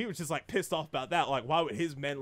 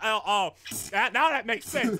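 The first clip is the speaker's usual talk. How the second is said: loud, raised dawning understanding; sudden realization; 6 semitones higher, 5 dB louder than usual. Retold or imitated. Viewer finally understands the mafia boss, sudden realization. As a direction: let the penny drop, amused.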